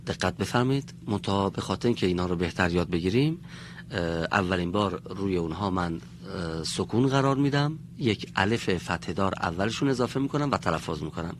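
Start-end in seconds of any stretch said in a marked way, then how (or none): none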